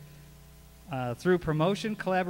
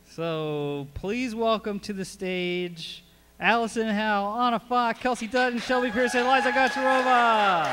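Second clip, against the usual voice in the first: louder, higher names called out; announcer intro; 5 semitones higher, 5 dB louder than usual. Real, not acted. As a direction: call the names out to the crowd. Welcoming the group to stage.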